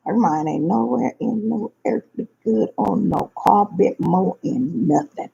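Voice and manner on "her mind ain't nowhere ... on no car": normal talking voice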